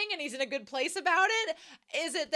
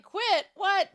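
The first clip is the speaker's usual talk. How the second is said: mock shock; 8 semitones higher, 7 dB louder than usual. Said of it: "And he's like".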